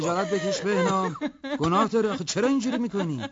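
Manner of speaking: high, pleading scold. Acted. Sister scolding Behnam for hurting his wife, high pleading. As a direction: shame him, begging.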